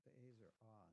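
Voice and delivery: normal talking voice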